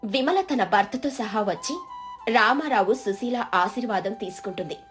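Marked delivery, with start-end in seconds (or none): none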